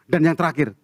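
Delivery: ordinary talk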